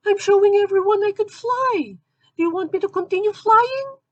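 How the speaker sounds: very high squeaky falsetto; innocent, eager heroine in a tiny voice; naive Supergirl. Acted.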